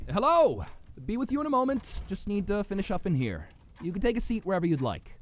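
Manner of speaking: high, projected call from the kitchen. Acted. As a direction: sing out to a customer in the next room. Innkeeper calls from the kitchen.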